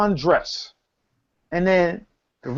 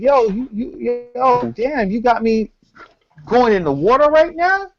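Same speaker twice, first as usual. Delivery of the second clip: freaked-out poor dude; 7 semitones higher than usual. Retold or imitated. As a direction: play the poor guy who can't believe he has to walk into the water. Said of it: "he's like".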